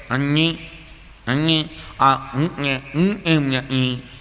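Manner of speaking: put-on slow nasal-speech boy voice. Nasal boy points out the camel to his mother. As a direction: speak through the nose, slowly. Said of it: "છોકરો ગૂંગણો".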